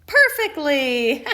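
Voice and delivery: slow sarcastic word, then a laugh; ironic, drawn-out perfectly. A narrator's reading. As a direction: sell the irony, slow.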